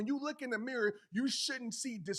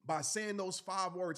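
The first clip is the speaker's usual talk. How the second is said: ordinary delivery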